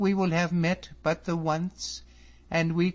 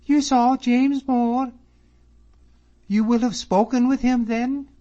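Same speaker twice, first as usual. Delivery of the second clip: joyful eager questions; 11 dB louder than usual. A narrator's reading. Miss Drummond eagerly asking David about her father, high and joyful. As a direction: press him gladly. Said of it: "with a voice of joy".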